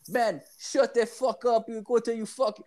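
high, annoyed Jamaican voice; angry Jamaican accent. Retold or imitated. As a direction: snap back in a Jamaican accent. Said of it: "the guy was, like, so mad".

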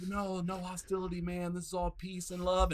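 hushed, placating imitation; mock pacifist. Monk pleading peace and love to angry man, mock soothing.

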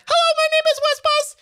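very high synthetic text-to-speech voice; robot voice high pitch. Retold or imitated. Demoing pitch control on a speech synthesizer, high robot voice. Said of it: "you can say, like".

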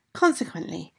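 plain reading voice

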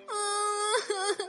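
high, soft wailing sob; quiet high-pitched whimpering wail; crying. A narrator's reading. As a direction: cry like an upset little girl. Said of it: "哭了起来".

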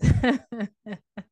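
acted gloating chuckle; smiling snake's laugh. Snake smiling at the dying man, gloating laugh. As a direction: laugh like a pleased villain. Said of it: "and smiling. He said".

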